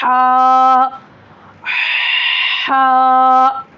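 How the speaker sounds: हा with the mouth wide open, from the middle throat. Shown as a demonstration. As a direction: breathe हा out loud, mouth open. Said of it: "मुँह खुलना।". (मुँह खोलकर साफ़ हा)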